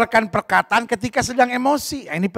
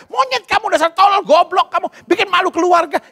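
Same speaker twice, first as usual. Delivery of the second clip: angry insults; 9 semitones higher than usual. Retold or imitated. Angry parent insulting the child, high and shouted. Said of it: "keluar kata-kata".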